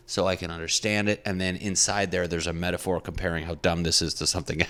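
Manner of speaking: ordinary delivery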